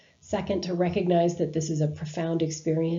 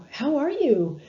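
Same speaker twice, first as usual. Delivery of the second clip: bright, high, louder peppy greeting; real chipper; 8 semitones higher, 5 dB louder, slower than usual. Shown as a demonstration. Nurse walking into a dying patient's room, overly chipper greeting. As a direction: breeze in overly cheerful. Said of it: "instead of kind of a real chipper".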